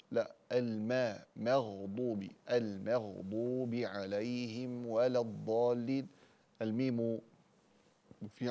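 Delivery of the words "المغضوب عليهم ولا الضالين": المغضوب with a thin mim, read slowly; light mim, slow. Shown as a demonstration. Teacher recites the corrected verse, slow and careful. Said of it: "ننتبه إلى ترقيق الميم".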